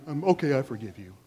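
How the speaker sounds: low, softened tender parent voice; soft forgiveness. Acted. Parent softening to a sorry child, acted tenderness. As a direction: melt and forgive quietly. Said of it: "you get all soft inside".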